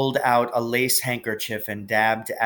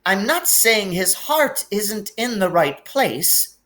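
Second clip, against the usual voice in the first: grudging; 9 semitones higher, slower than usual. A narrator's reading. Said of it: "said Professor McGonagall grudgingly".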